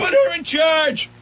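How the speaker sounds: high hammed-up shout; mock shout. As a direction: yell it like a panicked soldier. Movie quote shouted.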